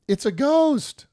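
high, drawn-out mock wail of fright; fearful cry. Terrified disciples spotting a figure on the lake, fearful cry. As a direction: loudly imitate panicked men crying out. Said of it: "they cried out".

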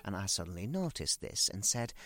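usual speaking voice

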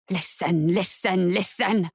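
low, insistent chanting spirits; chorus of voices. A narrator's reading. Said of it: "the chorus continued".

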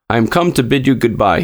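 usual speaking voice

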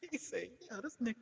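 high quiet laughing speech; soft laugh in the voice. Real, not acted. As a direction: quote through giggles. Laughing while quoting.

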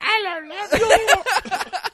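very high, loud, slow screechy Cockney; disgusting Cockney. Retold or imitated. Playing a supermodel with disgusting Cockney accent. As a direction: stretch it out in a grating Cockney screech. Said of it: "really disgusting Cockney accent".

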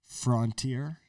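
"Frontier" with FRON rhyming with "on"; FRON-tier pronunciation. Shown as a demonstration. Repeating the word on request, "Frontier" as frawn-teer. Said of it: "Say that again?".

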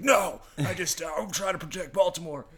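loud, high indignant protest; angry cop. Retold or imitated. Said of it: "how angry he seems".

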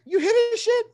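high, loud outraged cry; furious high-pitched outburst. Retold or imitated. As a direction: yell it in disbelief at the judge. Angry retort quoted at meet.

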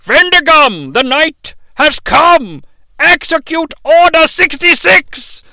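rally the troops like a tyrant; mock dictator rally. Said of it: "He's like".

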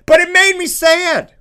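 high-pitched comic whine; hammed-up wounded complaint; mock upset. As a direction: wail it in mock heartbreak.

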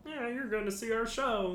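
high-pitched Muppet character voice. Retold or imitated. Muppets addressing the ride's audience in a high puppet voice. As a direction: do a high Muppet promising a show. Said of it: "like, the Muppets".

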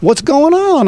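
mock chummy pal asking what's up; imitating Don. Retelling Don on the phone.

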